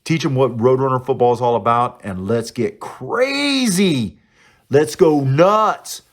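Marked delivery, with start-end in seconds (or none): 2.3-6.0 rowdy party animal. Real, not acted. Rallying fans to get crazy.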